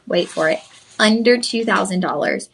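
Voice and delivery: ordinary talk, nothing marked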